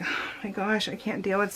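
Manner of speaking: soft, defeated voice on the edge of crying; quiet, overwhelmed, close to tears; a soft overwhelmed murmur, almost crying; near tears. Retold or imitated. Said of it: "So I felt like crying".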